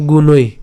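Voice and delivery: emphatic গুণৈ; clear pronunciation. Shown as a demonstration. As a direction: mark the oi of গুণৈ. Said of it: "উচ্চারণটা কেমন হবে".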